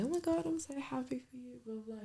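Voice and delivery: high, breathy-sounding mock congratulations trailing off; forced happy gush. To her engaged best friend, a high forced cheer. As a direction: fake it bright and high, then let it fizzle. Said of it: "I was already trying to, like, say".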